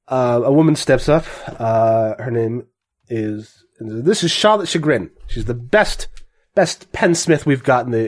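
ordinary talk, nothing marked

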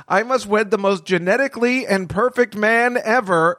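high, grand mock royal declaration; high-pitched mock princess announcing her quest; mock princess proclamation. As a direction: ham it up like a TV princess.

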